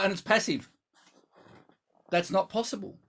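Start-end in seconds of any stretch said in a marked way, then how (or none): none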